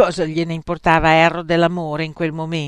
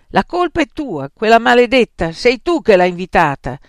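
loud, heated blame; indignant, raised-voice accusation; angry accusation; 4 semitones higher, 10 dB louder than usual. A narrator's reading. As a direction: throw the blame at her forcefully. Angry accusation between friends.

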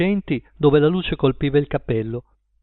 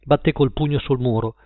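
ordinary delivery

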